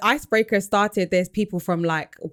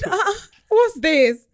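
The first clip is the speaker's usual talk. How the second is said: high, slow baffled question; 13 semitones higher, 6 dB louder, much slower than usual. Retold or imitated. Teller answering the boy at the icebreaker, high and slow baffled. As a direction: drag out the confusion, high.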